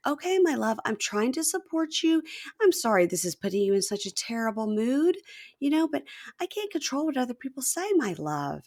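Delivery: high-pitched placating wife; Nicole. Retold or imitated. Wife apologizing to husband in a terrible mood, mock placating. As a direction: imitate a careful, soothing spouse. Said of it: "And she was like".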